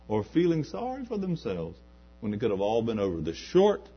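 usual speaking voice

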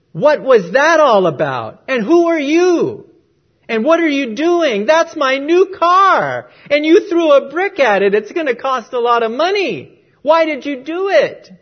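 put-on loud, high furious yelling at the boy; angry shout. Executive yelling at the boy over his new car, furious. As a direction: roar it at the kid who hit his car. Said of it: "he was so angry".

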